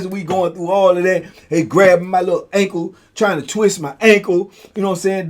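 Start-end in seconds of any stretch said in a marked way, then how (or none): none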